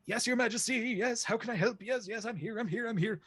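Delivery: very high, eager duck servant voice; high fussing duck butler answering his king; flustered duck servant. Acted. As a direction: rush in, eager to please, up high. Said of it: "It's like".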